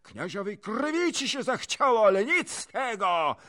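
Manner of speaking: very high, loud screeching insults; shrieking noblewoman's angry tirade; furious torrent of abuse. A narrator's reading. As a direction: pour out helpless rage at full voice. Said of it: "Bezsilny gniew kniazini wylał się tylko potokiem obelg".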